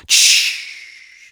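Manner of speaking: whispered shush. Acted. Detective hushes the shouting boy, whispered shush. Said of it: "przyłożył palec do ust".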